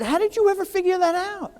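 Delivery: high, astonished question, voicing his partner; amazed partner. Partner on the phone asking how he did it, amazed and high. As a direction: play the partner stunned by the fix.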